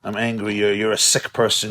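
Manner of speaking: ordinary talk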